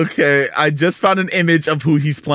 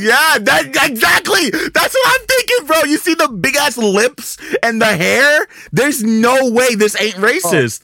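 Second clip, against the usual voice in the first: excited shout; 10 semitones higher than usual. Real, not acted. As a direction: cry it out in outrage.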